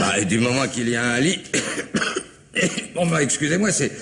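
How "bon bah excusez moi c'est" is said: coughing. Acted. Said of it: "je supporte mal la naphtaline".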